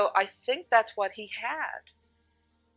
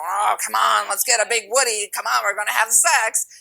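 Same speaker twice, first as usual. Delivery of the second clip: louder, pushy mock come-on; mock lusty urging; 3 semitones higher, 8 dB louder than usual. Mocking what she was not doing, loud pushy urging. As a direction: ham up a crude come-on. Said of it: "I wasn't down there going".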